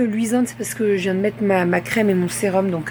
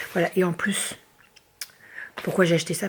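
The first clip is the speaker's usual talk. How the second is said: usual speaking voice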